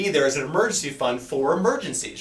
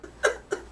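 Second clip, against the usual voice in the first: soft laugh; 4 dB quieter than usual. Acted. Skit ends in laughter.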